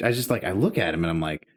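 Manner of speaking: normal talking voice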